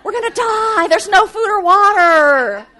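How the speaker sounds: high, shouted panic; panicked yelling. Acted. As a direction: yell it like you're sure you'll starve. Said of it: "Do you ever do that?".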